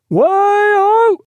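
very high, loud, long-held sailor's call; cry from aloft. A narrator's reading. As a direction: sing out a long hail from the masthead. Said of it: "and cried".

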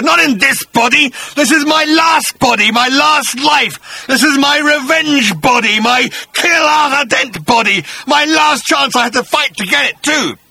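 high, loud monster rant, slowing on the last words; a bitter beast ranting about his last life; raging bat-creature. Acted. Agrajag rants at Arthur.

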